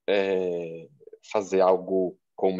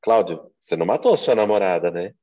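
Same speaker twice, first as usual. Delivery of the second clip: louder "Cláudio", pause, then "matou" pressed and "né" tagged on; confirmation tag; 5 dB louder than usual. Shown as a demonstration. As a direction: seek agreement on "você não matou sua namorada, né". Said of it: "falar a mesma frase de vários jeitos".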